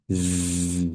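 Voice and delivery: long drawn-out buzzing z; bee buzz. Shown as a demonstration. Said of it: "making a long, drawn-out Z sound".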